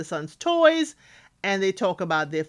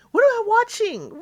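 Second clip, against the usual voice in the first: "What am I watching?" leaps much higher and a touch louder; disbelieving high repeat; 14 semitones higher, 4 dB louder than usual. Real, not acted. Host repeating her reaction to the goofing off, disbelieving high repeat. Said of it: "What exactly did I just watch".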